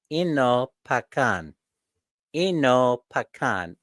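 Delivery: "Enopacan" drawn out slowly, e-no-pa-can; slow practice pronunciation. Shown as a demonstration. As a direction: rehearse "Enopacan" slowly and deliberately. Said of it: "I'll practice it".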